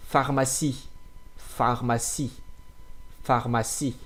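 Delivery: Pharmacie starting with f, c soft. Shown as a demonstration. Teacher modeling pharmacie for students. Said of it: "pronounce that like F".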